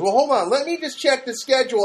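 lightly voiced receptionist putting him on hold; receptionist stalling.

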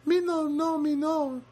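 very high, laughing mock Rosie; Rosie's broken English. Imitating Rosie refusing her photo, laughing and squeaky. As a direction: giggle through a coy refusal in broken English. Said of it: "That's how she talks".

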